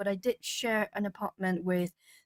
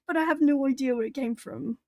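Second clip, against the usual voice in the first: ordinary talk, nothing marked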